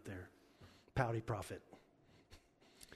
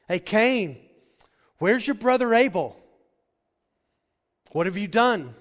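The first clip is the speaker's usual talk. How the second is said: loud, high challenge to Cain; God confronting; 8 semitones higher, 15 dB louder than usual. Acted. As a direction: call Cain out with full force.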